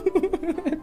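laughing high while asking. Real, not acted. Streamer laughs at teammate wandering off, laughing. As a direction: ask through laughter.

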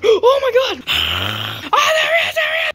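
high loud alarm; frantic shriek. Acted. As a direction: shriek on spotting him. Finding the fox.

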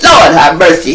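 ordinary talk, nothing marked

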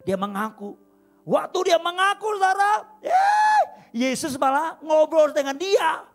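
slow, loud, high climactic preaching; rising preacher's shout. Real, not acted. Preacher telling the woman's confession, loud and slow. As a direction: stretch it out and cry it to the room.

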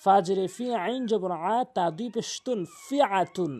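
ordinary delivery

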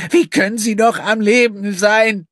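high, loud indignant outburst; protesting. A narrator's reading. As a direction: flare up in defiance. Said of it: "begehrte Gina auf".

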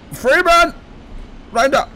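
high, loud urgent yell; shouting the name. Real, not acted. Player urging his character Freeman on, high loud yell. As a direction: yell at him to move now.

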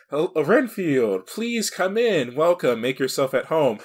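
swooping, over-gracious host, slowly inviting someone in; hammed-up courteous greeting, stretched at the start; Dracula. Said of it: "Dracula actually, like, surprises Renfield".

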